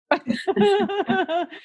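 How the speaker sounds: high burst of laughter; high loud laugh. Real, not acted. Laugh after threat.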